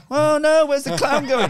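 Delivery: put-on high, loud children's wail; kids wailing. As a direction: cry out like upset kids, high. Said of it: "all the kids are going".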